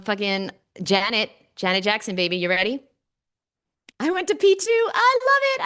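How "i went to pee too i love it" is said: much higher, louder gushing; gushy squeal. Real, not acted. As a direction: coo at the cuties in the chat. Gushing at the chat.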